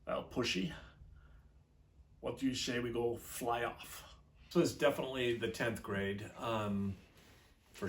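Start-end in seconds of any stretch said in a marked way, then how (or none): 0.1-4.0 lightly voiced Scottish Connery accent; teen imitating Sean Connery's Scottish burr, understated; a hint of Connery's Scottish brogue; Sean Connery impression. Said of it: "trying to do this Connery-like accent".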